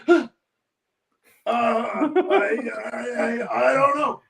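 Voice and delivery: flustered newcomer. Retold or imitated. Startled tenant answers the stranger, flustered and stammering. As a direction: stumble over the first word, retold in halting English. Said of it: "new Canadian fellow with rough English".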